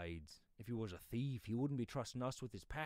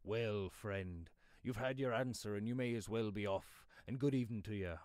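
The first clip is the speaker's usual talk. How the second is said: loud, curt father dismissing a stranger; rather sharp; 10 dB louder than usual. Acted. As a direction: send him off sharply and loudly. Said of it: "he said rather sharply".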